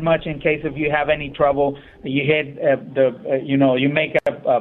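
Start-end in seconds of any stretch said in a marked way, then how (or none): none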